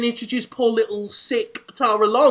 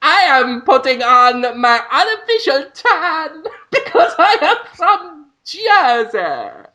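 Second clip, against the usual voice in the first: badly exaggerated reality-show character; Jersey Shore impression; 6 semitones higher than usual. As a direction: drag out every word in a silly high voice. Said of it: "That is my impression of the Jersey Shore".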